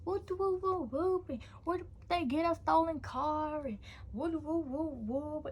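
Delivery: high-pitched mock rant; auntie going ham. Auntie on the phone about a stolen car, going ham. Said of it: "my auntie on the phone going ham".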